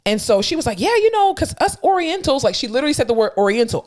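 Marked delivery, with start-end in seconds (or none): 0.8-2.4 high, breezy quoted voice; chatty coworker. Retold or imitated. Coworker chatting about herself to others, breezy and high. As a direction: imitate a casual aside, pitched up.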